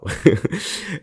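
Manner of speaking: short, high giggle; brief chuckle at your own example; amused laugh. Real, not acted. Podcaster joking about denying someone's tiredness, giggling.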